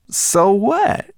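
high, loud shrugging retort; unbothered. Acted. Guide dismissing the brownie's fear, high and careless. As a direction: wave her worry away.

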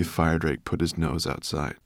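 ordinary delivery, nothing marked

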